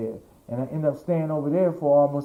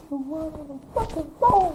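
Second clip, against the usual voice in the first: retold starstruck disbelief; 13 semitones higher, 4 dB quieter than usual. Designer telling manager who is calling, starstruck and overwhelmed. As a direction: squeal it under your breath in disbelief. Crying designer reveals famous caller.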